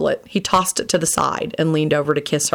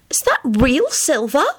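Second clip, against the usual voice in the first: amazed; 9 semitones higher than usual. A narrator's reading. Elizabeth amazed.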